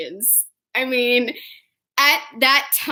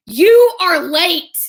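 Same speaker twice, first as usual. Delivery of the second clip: slow, loud, higher retold reprimand; stern scolding; 5 semitones higher, 7 dB louder, slower than usual. Tamaki scolds the twins for lateness, slow and stern. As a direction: come down hard, word by word.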